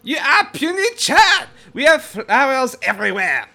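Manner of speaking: many bees mocking in French accent; French bee swarm voice. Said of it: "You hear this voice, like, multiplied".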